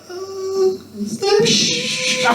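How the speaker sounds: very high flustered hush; embarrassed squeak. Retold or imitated. To his friends as she walks by, a squeaky flustered hush.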